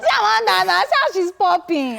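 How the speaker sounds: loud, high teasing cry; excited shout. Real, not acted. Host teasing co-host Amanda about her glowing makeup: loud, excited shout.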